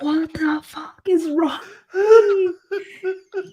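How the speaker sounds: slow, high, strained mock whisper; aghast whisper. Patient retells horror at own slip, aghast whisper. Retold horrified inner reaction, whispered.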